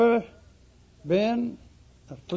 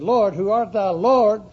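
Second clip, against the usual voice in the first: crying out; 3 semitones higher, 8 dB louder than usual. Retold or imitated. Saul's cry to the Lord.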